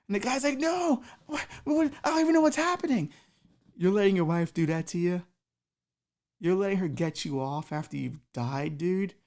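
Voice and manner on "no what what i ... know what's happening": high, louder protest; guy defending himself to Jesus; panicked guy. Acted. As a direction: plead confused and scared.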